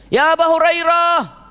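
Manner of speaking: high, loud, slow call; mother shouting for her son. Retold or imitated. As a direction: call the son's name across the house. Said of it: "Ibunya neriak kan?".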